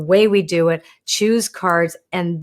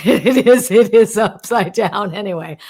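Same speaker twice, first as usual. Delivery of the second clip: laughing; 5 dB louder than usual. Real, not acted. Laughs at her own card.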